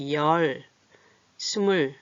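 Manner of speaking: 열 and 스물 each said alone with a pause; separated teaching read of 열, 스물; spaced-out counting. Shown as a demonstration. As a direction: count 열, 스물 slowly, one word at a time. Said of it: "띄어 읽어볼까요?".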